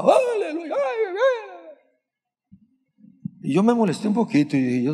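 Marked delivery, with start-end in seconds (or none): 0.0-1.8 high, drawn-out mock hallelujah; imitating gringo shouting. Preacher imitating American worshippers shouting hallelujah, high and stretched. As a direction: ham up the foreign worshippers' cry. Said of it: "¿cómo gritaba?".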